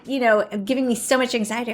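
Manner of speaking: usual speaking voice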